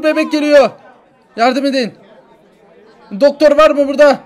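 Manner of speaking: high, loud desperate plea; frantic begging shout; screaming for help. Acted.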